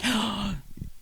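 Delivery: high, sharp pretend gasp; sudden theatrical intake of breath; mock gasp. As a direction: gasp as if struck by a revelation. Acting out a sudden discovery.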